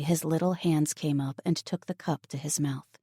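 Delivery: usual speaking voice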